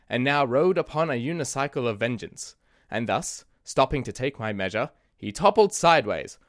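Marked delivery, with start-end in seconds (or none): none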